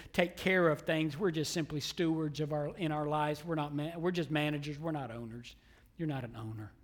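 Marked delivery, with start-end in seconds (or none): 4.8-6.7 low, soft aside to the congregation; quiet, lowered pulpit voice; hushed preaching. Real, not acted. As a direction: drop the voice right down to make the point. Preacher drops voice for effect.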